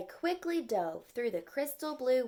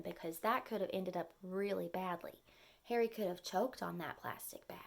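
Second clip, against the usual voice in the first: hushed warning; 3 semitones lower, 6 dB quieter than usual. Real, not acted. Teacher warning children about choking, in a whisper. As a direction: let the danger sink in softly.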